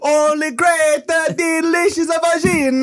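very high, slow cartoon Italian accent. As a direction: savor it in a high mock Italian voice.

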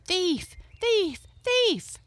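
crowd accusing cry. A narrator's reading. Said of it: "pointed their fingers at him and cried".

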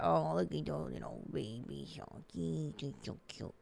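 soft cooing over something tiny. Real, not acted. Host cooing over tiny baby socks, soft baby talk.

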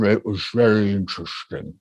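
slow, low, sluggish delivery; slow, slurred old talk. Shown as a demonstration. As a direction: drag every word as if thick-tongued. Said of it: "I'll do the way I used to talk now".

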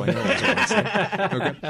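amused at his own joke; laughing. Real, not acted. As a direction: laugh through the words. Joking about his one Wednesday.

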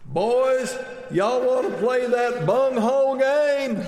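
put-on very high, loud, slow old lady holler; yelling mother-in-law. Mother-in-law yelling to the boys on a crowded beach, high and drawn out. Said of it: "She yells".

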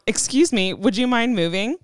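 ordinary delivery

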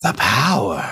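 acted awestruck mutter; muttering to himself. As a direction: marvel to yourself in character. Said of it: "he just mutters to himself".

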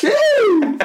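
very high mock "achoo"; hammed-up squealed sneeze; fake sneeze. Teacher faking a sneeze to escape, high achoo. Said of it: "I need his fake sneeze".

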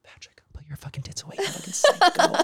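sharp hissed whisper, rising high. Retold or imitated. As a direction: whisper it urgently with a scolding edge. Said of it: "I was like".

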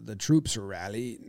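quiet, posh British officer; British accent. Pretend. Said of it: "Say it in an old-timey voice a little bit".

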